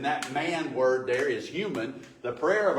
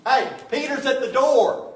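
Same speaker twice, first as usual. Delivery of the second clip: much higher and louder, bursting with news; excited announcement; 11 semitones higher, 10 dB louder than usual. Retold or imitated. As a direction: blurt out the news at the door. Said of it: "Rhoda goes".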